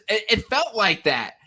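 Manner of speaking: ordinary delivery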